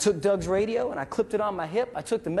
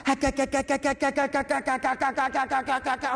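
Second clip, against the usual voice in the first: long run of rapid gah gah gunfire noise; excited machine gun sound; 6 semitones higher than usual. Retold or imitated. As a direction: rattle off a nonstop burst, carried away. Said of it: "he starts going".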